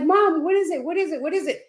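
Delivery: high, urgent repeated question; retold urgent concern. Daughter rushes to silent mother's bedside, retold urgency. Rushing to mother who suddenly called.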